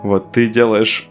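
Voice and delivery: ordinary delivery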